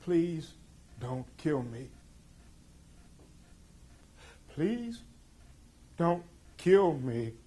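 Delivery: mild retold plea, the last please rising high and slow; a hint of a terrified boy begging; begging for his life. Boy at gunpoint begging not to be killed, mild retold plea. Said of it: "he's screaming".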